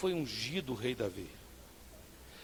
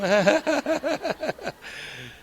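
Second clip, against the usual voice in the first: laugh; 6 semitones higher, 6 dB louder than usual. Real, not acted. Laughing at own joke.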